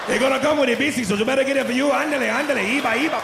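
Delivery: put-on heavy Spanish-accented cashier. Popeyes cashier repeating the order, mock Spanish accent. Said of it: "He said".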